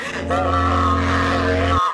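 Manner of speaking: mock gasp. Retold being strapped down.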